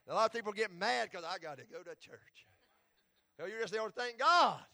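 loud, high sermon call; hammering the point home to the congregation; full-force preaching. Acted.

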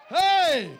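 loud, high, driving preacher's shout; fiery preacher, loud and high, drawn out; preaching at full force. Acted. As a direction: build the sermon to a roar.

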